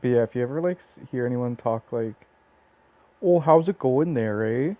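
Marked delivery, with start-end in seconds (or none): 3.2-4.7 mock Canadian accent. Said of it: "Literally no one talks like that".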